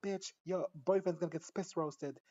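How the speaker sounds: high, punchy mock taunt; acted taunt. Acts out brother taunting his sister.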